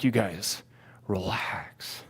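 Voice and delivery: soft, low, almost whispered; hushed calming. Retold or imitated. Speaker to crying pilgrims around him, hushed and unimpressed. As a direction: deflate it into a dismissive hush. Telling frenzied crowd to calm down.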